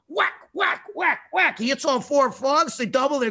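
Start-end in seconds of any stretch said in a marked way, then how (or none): none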